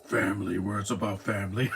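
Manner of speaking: a light Vin Diesel Batman take. Retold or imitated. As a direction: imitate a gruff Batman line about family. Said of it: "Vin Diesel doing the voice of Batman".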